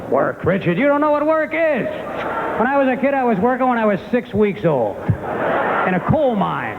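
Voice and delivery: high-pitched, hammed-up dad tirade; mock indignant dad, stretching the boasts; ranting father. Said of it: "My father'd be like".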